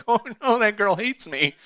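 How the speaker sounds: high, loud panicked "oh no"; dismayed panic. Retold or imitated. Panic on learning whose drink.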